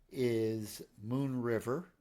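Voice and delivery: ordinary talk, nothing marked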